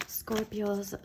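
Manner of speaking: normal talking voice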